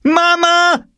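put-on very high, loud girl's cry; girl calling out. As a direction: Jule calling her mother for help, very high and loud. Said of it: "schrie Jule".

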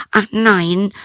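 put-on a frightened girl answering; princess. Princess answering her father, high and frightened.

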